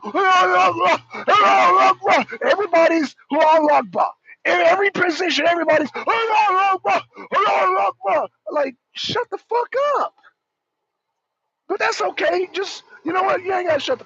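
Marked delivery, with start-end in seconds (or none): 0.0-8.3 higher, louder chanted yells; mock battle cry. Mocking fighters running around yelling, loud mock chant. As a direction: shout it again and again, mocking. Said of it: "motherfuckers running around".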